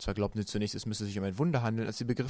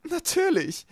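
very high, loud singsong reply; smug villainess; 7 dB louder, slower than usual. A narrator's reading. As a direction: toss it down from way up high. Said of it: "antwortete Esme". (selbstgefällig, sehr hoch und laut)

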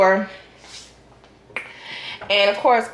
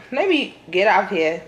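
ordinary delivery

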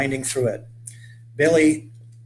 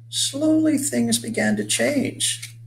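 drawn-out, much higher narration; high, slow storyteller's build; slow suspense; 11 semitones higher, slower than usual. A narrator's reading. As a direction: stretch it out slowly in a high, mysterious voice. Suspense as the vision begins.

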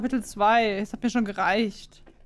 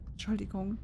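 whisper; 10 dB quieter than usual. Real, not acted. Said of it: "Muss jetzt leise sein".

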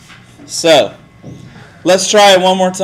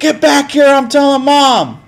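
whiny tattling little sibling; 9 semitones higher than usual. Acted. Threatening to tattle on kid.

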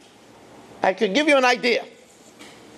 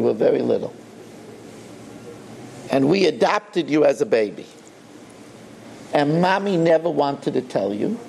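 a hint of a frail old father, lower and hushed; dying father; 7 semitones lower than usual. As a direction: lightly voice the dying dad confessing gently. Dying father confesses to son.

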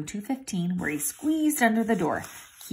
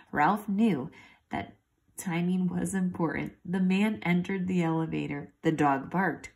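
ordinary delivery, nothing marked

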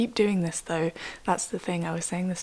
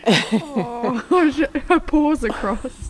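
laughing; 5 semitones higher, 6 dB louder, much slower than usual. Real, not acted. Laughing at puppy's crossed paws.

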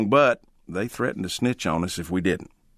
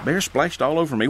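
usual speaking voice

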